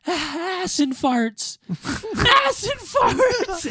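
put-on high, slow, laughing drunk voice; imitating drunk friend. Retelling a drunk friend's call, high and laughing. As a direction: ham up the drunk buddy's joke. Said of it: "He goes".

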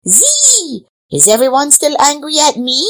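high-pitched mosquito whine; whining mosquito. A narrator's reading. Mosquito with a guilty conscience, whining in people's ears. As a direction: buzz in high, then ask plaintively. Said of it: "whining in people's ears".

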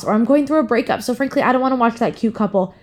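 ordinary talk, nothing marked

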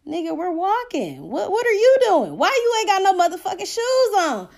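high-pitched acted exasperation; retold irritated comeback; indignant challenge. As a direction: challenge him in mock disbelief. Indignant acted challenge to shoeless man.

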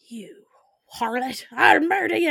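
whispered start exploding into high, loud fury; mock jealous rage. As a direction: hiss one word, then roar theatrically. Jealous man explodes, acted.

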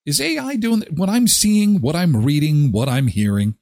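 high, wondering self-questioning; wondering aloud. Retold or imitated. Listener asking themself, high and wondering. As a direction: ask it high as if puzzled. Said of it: "you're going to ask yourself".

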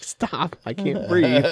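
high, pleading stop, falling at the end; mock panic. Patient retells begging the dentist to stop, high mock panic.

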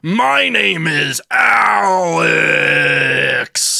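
high, drawn-out shout; bellowed name. Acted. Host announces himself to the caller, bellowing. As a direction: bellow it slowly, stretching the name. Performed skit, shouted catchphrase.

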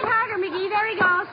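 high, urgent cries; excited urging. Acted. Wife following the escaping owl, excited. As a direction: cheer the chase on, pitched high. Chasing owl through the room.

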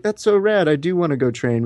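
retold eagerness. Husband reacting to wife's offer of training, retold excitement. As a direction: lightly act thrilled by an offer.

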